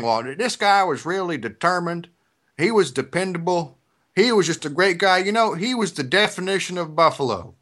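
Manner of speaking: imitating the mayor's thick Tennessee accent. Quoting the Tennessee mayor in his Southern accent. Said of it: "so he's saying".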